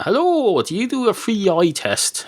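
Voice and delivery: high-pitched hello; acted customer greeting. Customers walking into the opticians, high acted greeting. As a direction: pitch it right up as you enquire.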